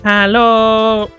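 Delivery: long, loud, high hello; hammed-up high greeting; goofy meme hello. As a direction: stretch hello out loudly. Repeats the goofy hello bit.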